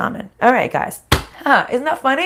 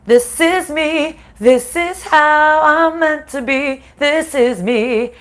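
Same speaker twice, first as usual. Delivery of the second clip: louder and higher, slow and insistent; emphatic self-affirmation; 7 semitones higher, 5 dB louder, slower than usual. Retold or imitated. Reassuring herself on her live stream, loud and emphatic. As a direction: declare it like a rallying cry to yourself. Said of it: "And I was like".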